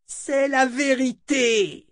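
very high, drawn-out cry; seer's piercing insistence; fierce cry. A narrator's reading. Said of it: "cria Chota".

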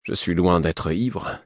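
ordinary delivery, nothing marked

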